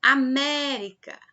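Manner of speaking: América, loud and slow on mé; loud, held MÉ inside América; stress on mé. Shown as a demonstration. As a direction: stretch and punch the mé in América. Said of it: "mé é a sílaba mais forte".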